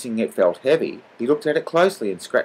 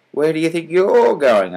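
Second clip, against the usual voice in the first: higher, louder suspicious challenge; 7 semitones higher, 6 dB louder than usual. A narrator's reading. Mean salesman questioning his rival, suspiciously. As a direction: demand it with suspicion. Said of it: "asked mean Mister Johnson suspiciously".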